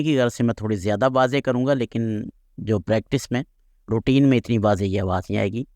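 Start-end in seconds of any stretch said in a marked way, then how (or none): none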